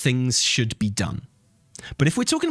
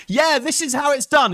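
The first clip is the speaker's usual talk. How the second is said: mocking chat voice; 10 semitones higher, 3 dB louder than usual. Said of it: "Why are you like".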